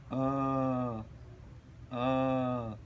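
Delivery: low, held "A"; sustained teaching "A", repeated; long vowel demonstration. Shown as a demonstration. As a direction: draw each "A" out evenly. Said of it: "Now I will pronounce".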